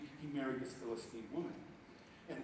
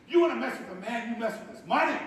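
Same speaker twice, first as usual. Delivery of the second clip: mock angry taunt; 7 semitones higher, 10 dB louder than usual. Samson's taunt in sermon.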